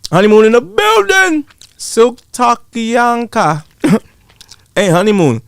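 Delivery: high, drawn-out hype call; hype shout-out. Real, not acted. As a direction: announce them like a hype man.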